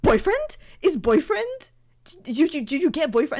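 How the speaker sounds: high, loud excited mock family quizzing in broken English; imitating family's broken English. Family teasing her over the Josh Dreyfuss photo, loud high mock accent. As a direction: parrot the family pouncing with a high, loud 'Boyfriend?'. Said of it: "all of them went".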